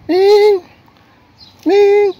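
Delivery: high, loud call across the yard. Real, not acted. Woman calls her cat Ming from afar, loud high call. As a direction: call the cat's name out loud.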